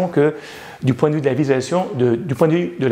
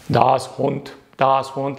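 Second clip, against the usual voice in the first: robot voice; 4 semitones lower, much slower than usual. Shown as a demonstration. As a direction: lightly lower robot voice. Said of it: "la voix de robot".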